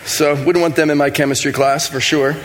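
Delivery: ordinary delivery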